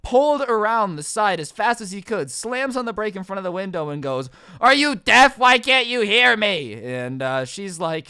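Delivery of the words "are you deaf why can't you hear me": loud, higher-pitched irate yell; angry customer. Retold or imitated. Drive-thru customer screams at the worker, angrily. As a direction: shout it slowly, acting out a furious customer. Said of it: "slowly getting angrier and angrier".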